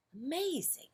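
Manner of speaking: high awed whisper; whispered amazement. A narrator's reading. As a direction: breathe it out in wonder. Mouse whispers in wonder.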